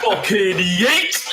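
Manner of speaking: high, drawn-out launch yell; throwing shout. Retold or imitated. Friend flinging Ricky off in VR, high stretched yell. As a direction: shout it as you hurl someone.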